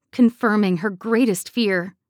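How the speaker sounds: usual speaking voice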